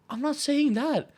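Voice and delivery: shrieking at a friend, half in jest; exasperated retort. Retold or imitated. Retelling his retort to Dennis, high exasperated mock outburst. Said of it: "And I'm like".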